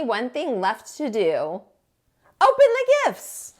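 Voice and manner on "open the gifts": much higher, louder cheer; excited shout. A narrator's reading. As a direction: burst out like a kid on Christmas. Excited gift opening.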